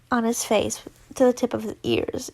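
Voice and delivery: plain reading voice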